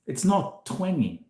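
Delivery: normal talking voice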